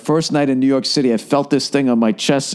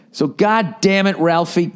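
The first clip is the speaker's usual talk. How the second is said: ordinary talk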